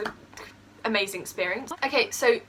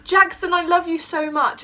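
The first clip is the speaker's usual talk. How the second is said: gushing; 7 semitones higher, 5 dB louder than usual. Retold or imitated. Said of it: "So I went".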